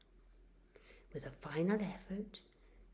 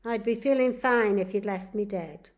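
louder grumbling complaint; grumbled; 3 semitones higher, 10 dB louder than usual. Acted. Charlie grumbles at the nurse who revived him, louder and cross. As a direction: grouse at the nurse. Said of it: "he grumbled".

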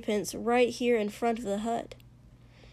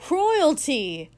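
loud, higher booming greeting; 5 semitones higher, 5 dB louder than usual. A narrator's reading. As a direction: boom it out with delight. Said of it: "Toshi boomed".

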